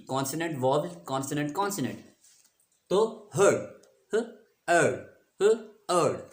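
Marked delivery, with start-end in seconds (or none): 4.1-6.2 ह then अर्ड, split twice; syllables pulled apart for students; sounded out. Shown as a demonstration. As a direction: break हर्ड into ह and अर्ड. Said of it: "short vowel है".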